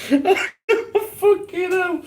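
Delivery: real swearing through helpless laughter; breathless laughing curse. Real, not acted. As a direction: squeeze it out mid-laugh, high and drawn out. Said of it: "it makes me laugh".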